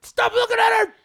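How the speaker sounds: high, loud jealous yell; husband yelling at the burlesque crowd, high and loud; jealous shout. Retold or imitated. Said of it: "and I'm like".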